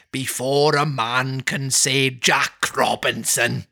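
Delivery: slow Welsh-tinged jolly line; a hint of the Welsh master; jovial Welsh. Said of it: "jovial Welshman".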